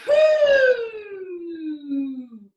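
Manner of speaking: big loud whoo on the out-breath; loud release of breath. Shown as a demonstration. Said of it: "and go".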